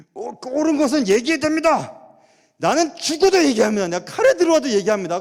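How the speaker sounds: loud, high, insistent proclamation; mock the stubborn believer who must speak out; righteous declaration. Mocking self-righteous believers.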